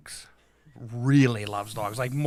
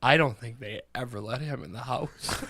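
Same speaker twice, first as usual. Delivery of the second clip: mock sobbing; 3 dB quieter than usual. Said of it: "she's fucking sobbing".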